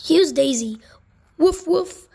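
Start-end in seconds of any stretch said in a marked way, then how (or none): 1.4-2.1 high, loud yappy puppy barks. Acted. Reader barking as Biscuit the puppy, high and loud. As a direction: yip like a little puppy, much higher.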